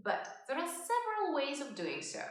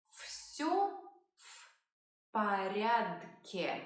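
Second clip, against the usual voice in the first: each word said slowly and apart; 3 semitones lower, much slower than usual. Shown as a demonstration. Teacher splitting the phrase into slow words. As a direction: break it into separate words.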